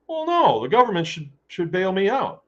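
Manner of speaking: mocking whiny farmer. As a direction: ham up a farmer begging for a bailout. Mock whiny farmer asking bailout.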